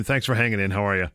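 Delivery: ordinary delivery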